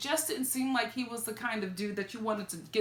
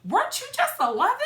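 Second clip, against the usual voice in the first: mock disbelief; 10 semitones higher, 4 dB louder, faster than usual. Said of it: "I'm like".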